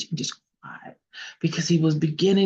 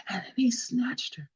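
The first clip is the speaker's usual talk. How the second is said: plain reading voice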